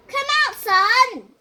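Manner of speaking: slow loud storybook shout. A narrator's reading. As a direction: call the sun out from behind the barn. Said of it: "shouted Cow".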